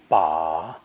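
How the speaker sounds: "ba" drawn out, dipping low. Shown as a demonstration. Teacher running through four tones, low dipping "ba". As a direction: stretch "ba" down low in a slow dip. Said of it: "with a low dipping tone".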